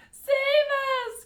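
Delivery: shrill-high, very loud cry for help; cried out. A narrator's reading. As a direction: scream for rescue as the roof falls. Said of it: "cried Grandma Josephine".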